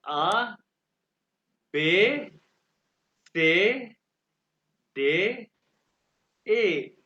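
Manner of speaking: loud, separate letters "आ, बे, से, दे, ए", long vowels; German alphabet demo. Shown as a demonstration. Teacher reads the alphabet for students to hear, loud pronunciation demo. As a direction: model "आ, बे, से" one by one with clear pauses. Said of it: "आपने alphabets गौर से सुने हैं".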